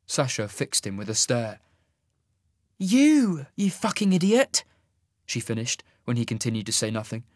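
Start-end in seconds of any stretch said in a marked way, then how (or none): 2.8-4.6 exasperated tender. A narrator's reading. Sasha affectionate exasperation.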